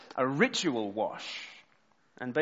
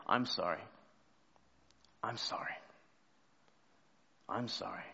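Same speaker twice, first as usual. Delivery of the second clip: quiet apology; 5 semitones lower, 9 dB quieter than usual. Retold or imitated. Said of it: "say them under my breath".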